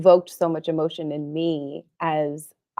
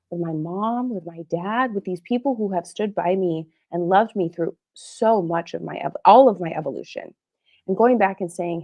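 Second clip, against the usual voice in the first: normal talking voice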